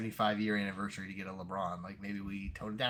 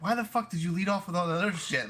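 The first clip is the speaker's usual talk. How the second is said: mock exasperated yell; 9 semitones higher, 5 dB louder than usual. Coworkers exasperated, Office retelling.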